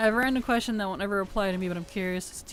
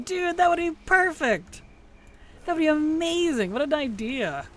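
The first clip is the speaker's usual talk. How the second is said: excited; 8 semitones higher, 4 dB louder than usual. Real, not acted. Excited by chat's idea.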